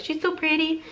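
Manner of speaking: high-pitched adoring coo; gushing admiration. Retold or imitated. Vlogger admiring her new bag in the viewfinder, high gushing coo. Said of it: "I keep looking at it in the viewfinder like".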